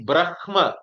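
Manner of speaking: h sounded before m in Brahma; Brahma as written. Shown as a demonstration. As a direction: pronounce Brahma letter by letter, h then m. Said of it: "the written form will be".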